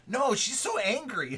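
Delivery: put-on worried little kid; son's voice again. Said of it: "I'm like".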